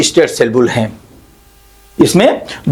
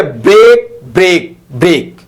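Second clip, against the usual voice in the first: three separate beats on "break, break, break"; every word stressed. Shown as a demonstration. Teacher quoting Tennyson's spondees, each word stressed. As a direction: land firmly on each "break", pausing between. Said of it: "इसे stress दोनों पे जोर दिया जाता है".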